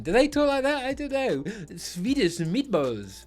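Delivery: put-on singsong Swedish accent; mock Swedish accent. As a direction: play up a comic Swedish lilt. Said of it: "That's how they speak".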